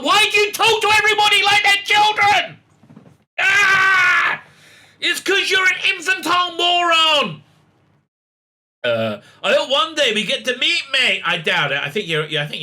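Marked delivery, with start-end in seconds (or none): 0.0-7.4 high, loud exasperated yell; much higher, louder ranting outburst; mock-furious shout. Real, not acted. Ranting at Whittaker's tone. 9.4-11.2 mock gushing presenter. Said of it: "Whittaker told him".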